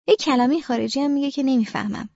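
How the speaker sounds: plain reading voice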